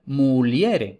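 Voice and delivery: muliere with IE as one diphthong; Spanish-style muliere. Shown as a demonstration. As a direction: read muliere the Spanish way, mu-LYE-re. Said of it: "si lo leyéramos a la española".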